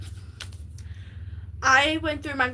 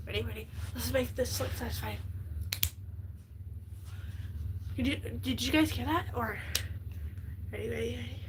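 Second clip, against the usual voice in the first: softer, hushed show-and-tell; soft aside to viewers; 2 semitones lower, 11 dB quieter than usual. Real, not acted. Showing viewers a lipstick on stream, softly hushed. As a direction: murmur it close to the mic.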